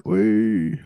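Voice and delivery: usual speaking voice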